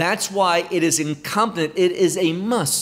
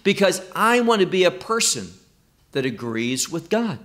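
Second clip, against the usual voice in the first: normal talking voice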